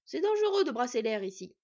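loud warning; stern order. A narrator's reading. Smith warning twins in his forge, stern and loud. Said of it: "leur ordonna le forgeron des étoiles".

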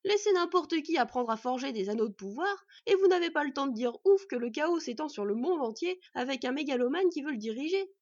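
agitated smith raising his voice; exclaimed warning. A narrator's reading. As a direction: warn them forcefully of the disaster. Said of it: "s'écria Nuri".

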